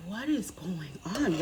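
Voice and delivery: whispered, slow and soft; whispered worry. Retold or imitated. Retelling her night headache to viewers, whispered. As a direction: breathe it out in pain. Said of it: "I'm like".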